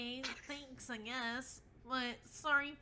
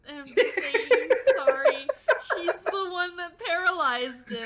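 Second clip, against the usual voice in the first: loud, laughing disbelief; laughing outrage; 6 semitones higher, 15 dB louder than usual. Real, not acted. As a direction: blurt it out, cracking up at the outrage.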